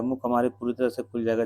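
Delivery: usual speaking voice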